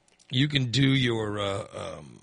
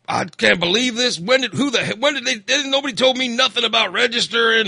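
higher and louder indignant rant; retold angry voter; 8 semitones higher, 5 dB louder than usual. Unregistered voter ranting at the election desk, indignant and loud. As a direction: act out a fed-up voter who nobody warned. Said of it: "that person will be angry, pissed off".

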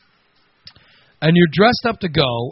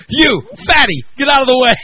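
high, louder mock yell of a rude photographer; rude paparazzo shout; 8 semitones higher, 5 dB louder than usual. Paparazzo yelling at her to move, a mock yell. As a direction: bark the insult like a rude paparazzo.

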